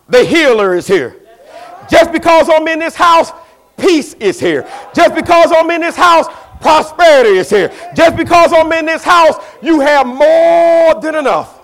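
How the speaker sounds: loud, high, building proclamation; preaching as God. Acted. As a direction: proclaim God's words, rising each time.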